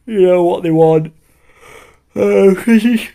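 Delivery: talking through a yawn; stretched high yawn through the words. Real, not acted. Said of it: "I'm yawning".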